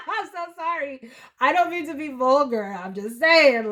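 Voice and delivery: embarrassed laughing. Real, not acted. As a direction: giggle through the apology. Giggles at own vulgar joke.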